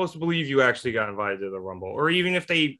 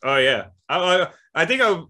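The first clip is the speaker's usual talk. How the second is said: usual speaking voice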